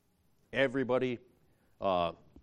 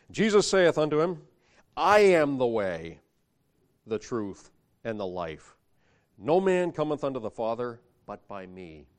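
scripture reading; 5 dB louder than usual. Acted.

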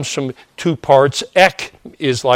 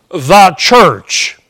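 "the" said as a loud, emphatic THEE before church; "the church" with heavy weight on THE; stress on THE; 5 semitones higher, 7 dB louder than usual. Real, not acted. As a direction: punch "the" high and loud, as in the one church. Contrasts universal church with local congregation.

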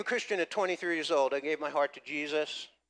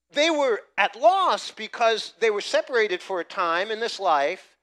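raised, ringing preacher's voice, hope held long; full-force preaching; 5 semitones higher, 6 dB louder than usual. Acted. Pastor proclaiming hope of heaven to congregation, preaching at full force. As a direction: preach it out to the whole room.

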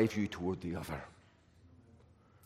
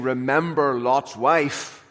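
each word of "Remember Lot's wife" drawn out slowly and loud; slow warning; 5 dB louder, slower than usual. Retold or imitated. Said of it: "Davis would say".

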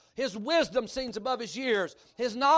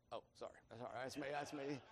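a hushed, much lower and softer mumbled sorry; sheepish apology; 10 semitones lower, 19 dB quieter than usual. Retold or imitated. Preacher owning up to the pastor, hushed sheepish sorry. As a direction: own up under your breath. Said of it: "and I went".

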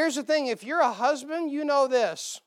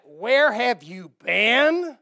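mock blast; 9 dB louder, much slower than usual. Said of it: "give that blast like".

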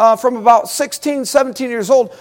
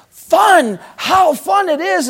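excited exclamation; 6 semitones higher, slower than usual. Retold or imitated. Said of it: "He's just all excited".

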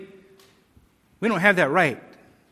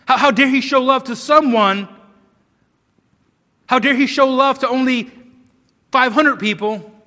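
loud, higher, outraged challenge; mock indignation; 5 semitones higher, 6 dB louder than usual. Preacher voicing objectors to God's choosing, loudly indignant. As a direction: act out an affronted protest with raised voice. Acted accusation against God.

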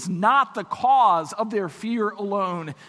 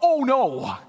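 mock-horrified realization, higher; a raised, dismayed inner voice; dawning dismay; 5 semitones higher, 4 dB louder than usual. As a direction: act out a doorman's sinking realization. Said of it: "that doorman thinks to himself".

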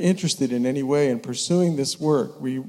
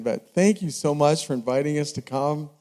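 normal talking voice

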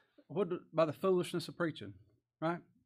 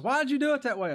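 high, loud imitation of a complainer; acted indignant challenge, loud and high; mock accusing question; 12 semitones higher, 8 dB louder, faster than usual. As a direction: demand an explanation, voice pitched right up. Acting accusers in retold quarrel.

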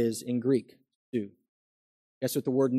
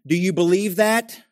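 high, loud building sermon; preaching at full force; 5 semitones higher, 5 dB louder than usual. Real, not acted. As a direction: press the point home to the congregation.

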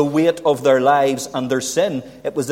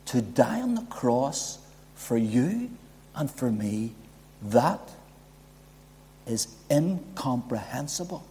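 usual speaking voice

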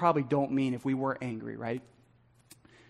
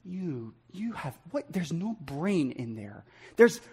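very quiet, halting grumble to yourself; hushed, acted-out angry mumbling; mumbling under breath; 3 semitones lower, 8 dB quieter, slower than usual. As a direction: mutter the insult under your breath. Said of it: "start mumbling under our breath".

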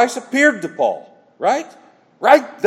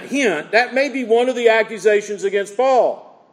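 ordinary delivery

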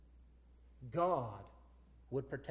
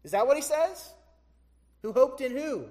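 incredulous; 8 semitones higher, 11 dB louder than usual. Acted. Preacher mocking a wrong reading.

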